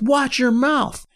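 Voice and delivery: high, slow mock warning; getting mad. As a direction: imitate a drunk fan taking offence. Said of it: "he started getting mad".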